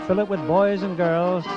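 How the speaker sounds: ordinary talk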